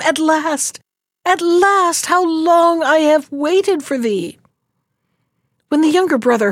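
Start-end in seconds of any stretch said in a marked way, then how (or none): 0.0-0.8 calling out. Acted. Princess calls down to the rider, eager and high. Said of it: "she called to him". 1.2-2.1 even higher, fuller second "at last" with a falling end; calling out, rising. Acted. Princess calls to her returning husband, overjoyed. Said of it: "she called to him". 2.1-4.4 higher, slowed glad call; joyful calling. Acted. Princess calls from the balcony, joyful and high. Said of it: "she called to him".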